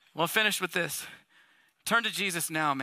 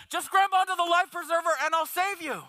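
captain calling out; 6 semitones higher than usual. Acted. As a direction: shout it out like a rescuer. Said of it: "all he says is".

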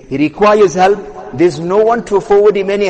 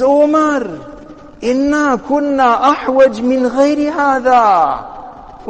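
earnest scolding, pitched up and stretched; acted reproach; 5 semitones higher than usual. Leader reproving Umar for threatening a man, acted reproach.

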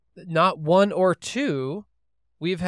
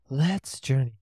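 therapist voice; 2 semitones lower, slower than usual. Pretend. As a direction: lead them gently like a therapist. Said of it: "you have to use the therapist voice".

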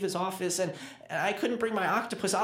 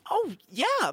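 excited realization; 9 semitones higher, 5 dB louder than usual. Acted. Sudden realization of passcode.

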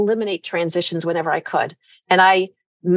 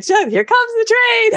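playful train voice; 4 dB louder than usual. Retold or imitated. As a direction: call it out like a toy train. Playing trains with the kids.

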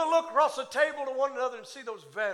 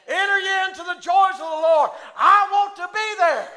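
loud, high, shouted, stretched no more; preaching at full force; 8 semitones higher, 15 dB louder than usual. Acted. Preacher shouting heaven's promise to the congregation, triumphant. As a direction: build to a triumphant shouting climax.

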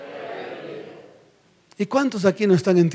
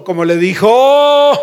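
loud prompt with drawn-out ending; calling for response; 3 semitones higher, 6 dB louder, slower than usual. Real, not acted. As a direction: stretch it out for the crowd. Prompts congregation to answer.

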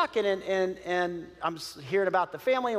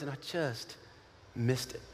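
choked-up regret; 8 semitones lower, 10 dB quieter than usual. Retold or imitated. Man tearfully confesses regret.

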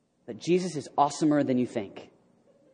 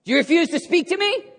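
high, indignant threatening demand; mock-imperious threat; Pilate; 13 semitones higher, 4 dB louder than usual. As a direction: challenge the prisoner with raised pitch. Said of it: "Pilate kinda threatened".

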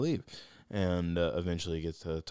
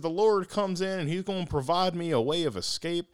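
Barack Obama impression; 11 semitones higher, 6 dB louder than usual. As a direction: do Obama drawing out every phrase. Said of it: "a little Barack Obama impression in there".

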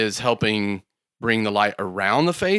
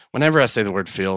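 ordinary talk, nothing marked

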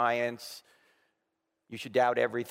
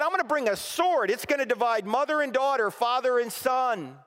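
forceful declaration; 10 semitones higher, 4 dB louder than usual. Acted. Preacher voicing Jesus.

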